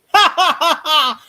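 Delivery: loud, high staccato cackle; hammed-up burst of ha-ha-ha; mock laugh. Retelling a comedy bit to friends, mock cackle. Imitating a performer's exaggerated laugh.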